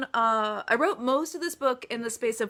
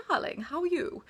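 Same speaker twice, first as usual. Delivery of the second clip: toffee front-of-the-mouth British accent, higher-pitched; upper-crust British accent; 5 semitones higher, 4 dB quieter than usual. Shown as a demonstration. Author giving fans a sample of British Gale, upper-crust British accent. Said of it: "very, like, toffee front of the mouth".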